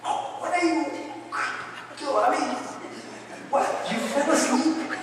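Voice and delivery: high-pitched, half-laughing scolding; exasperated husband, played for laughs; mock snapping. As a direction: pretend to scold your wife, not in earnest. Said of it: "I'm gonna snap at my wife".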